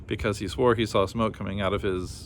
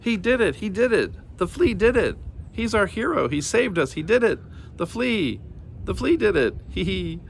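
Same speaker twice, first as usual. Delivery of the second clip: fly cheering the flea; excited cheering; 10 semitones higher than usual. A narrator's reading. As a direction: cheer the hero with glee. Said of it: "the fly was very excited".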